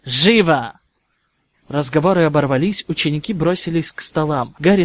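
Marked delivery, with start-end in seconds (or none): 0.0-0.8 sharp command. Acted. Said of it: "скомандовал профессор Снейп".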